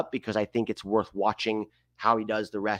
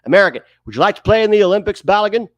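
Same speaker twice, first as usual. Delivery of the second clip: high, loud and fast mock British reporter; fast, excited British reporter voice; British interviewer accent; 8 semitones higher, 9 dB louder, faster than usual. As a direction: push the mic at the player in a hammed-up British accent. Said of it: "the interviewer is British".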